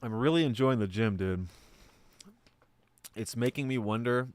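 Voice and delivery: talking through a mouthful; speaking while chewing food. Real, not acted. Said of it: "I'm chewing up too much".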